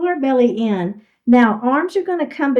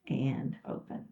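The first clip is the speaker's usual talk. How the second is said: last cue dropping to a whisper, much softer; fading whisper; 9 semitones lower, 15 dB quieter than usual. Real, not acted. Instructor finishing the last rep, whispered. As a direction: let the final cue fade to breath.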